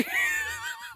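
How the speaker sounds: a sudden high shrieking laugh. Real, not acted. Laugh shout at the ram.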